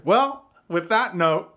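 high, loud, drawn-out showman. Acted. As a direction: play the showman signing off with a flourish. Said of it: "Yeah, the showman voice".

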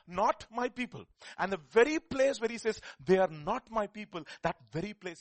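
loud and high declaration; full-force preaching. Real, not acted. Preacher declaring to the church, loud and high. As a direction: drive the point home.

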